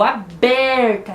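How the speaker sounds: "aberta" with a long, strong BER, slowly; "aberta" with its second syllable stretched out; stress on BER. Shown as a demonstration. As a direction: hit and hold the middle of "aberta". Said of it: "o ber é a sílaba tônica".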